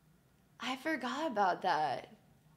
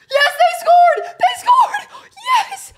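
screaming with joy, much higher and louder, laughing; excited shout; 16 dB louder, much slower than usual. Real, not acted. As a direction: yell it out, thrilled the puck went in. Team just scored a goal.

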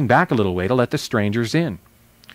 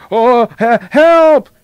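high, loud startled yelp; panicked cry for help; 12 semitones higher, 10 dB louder, much slower than usual. A narrator's reading. Horse tickled by the lion's whiskers, panicked cry. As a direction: cry out sharply in sudden fright.

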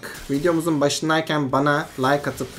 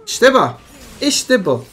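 loud, high celebration; triumphant cheer; 5 semitones higher, 7 dB louder, slower than usual. Real, not acted. Gamer cheering own triple kill, triumphant. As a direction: punch out the victory cry.

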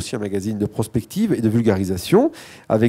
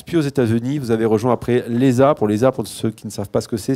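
normal talking voice

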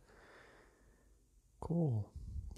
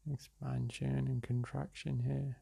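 unhurried meditation-guide voice with long pauses; guided meditation; slower than usual. Real, not acted. Meditation guide leading listeners through breath and release, calm and unhurried. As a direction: guide them gently through each breath, leaving space between phrases.

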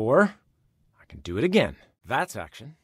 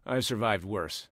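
ordinary talk, nothing marked

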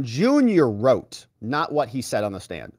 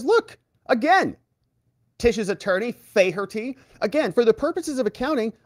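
much higher, slowed, put-on voice of Don Junior; imitating Don Junior; 9 semitones higher than usual. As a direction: play him as a defensive witness. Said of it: "He says".

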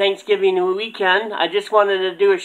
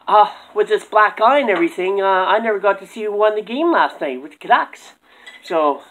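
ordinary talk, nothing marked

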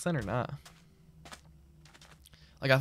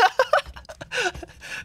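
high-pitched burst of laughter; laughing. Real, not acted. Streamer excited about a possible guest, high laughter.

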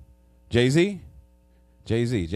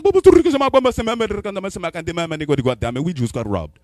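rapid mock-African babble ending in English; mock African accent; 5 semitones higher, 9 dB louder than usual. African roommate repeats the robbery news, mock-accent babble. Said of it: "he mix it with English".

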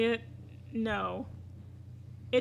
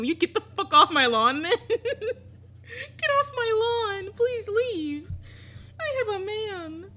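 high, loud laughing sob; a very high, laughing, tearful plea; laugh-crying plea; 10 semitones higher, 6 dB louder, slower than usual. Real, not acted. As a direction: beg through laughter. Begging him to leave.

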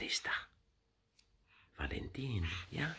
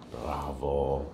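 ordinary delivery

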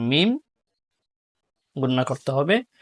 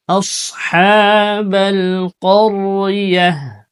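slow, louder chanted recitation; Quran recitation; 5 semitones higher, 4 dB louder, much slower than usual. Shown as a demonstration. Teacher reciting the verse for students. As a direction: draw every word out in recitation.

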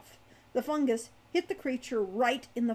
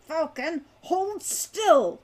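sharp raised command, higher and louder; snapped order; 6 semitones higher, 7 dB louder, much slower than usual. A narrator's reading. Hawk ordering Falcon to hold still, snapped. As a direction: snap it at your companion. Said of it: "Hawk snapped".

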